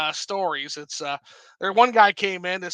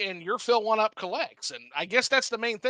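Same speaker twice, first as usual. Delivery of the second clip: usual speaking voice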